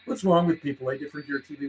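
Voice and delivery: ordinary talk, nothing marked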